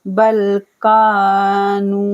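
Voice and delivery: slow recited word; Quran recitation. Shown as a demonstration. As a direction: chant the word slowly. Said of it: "काफ को भी खींचना है".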